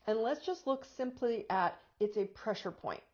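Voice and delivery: normal talking voice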